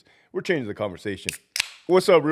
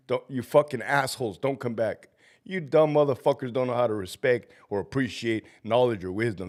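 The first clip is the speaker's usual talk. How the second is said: ordinary talk, nothing marked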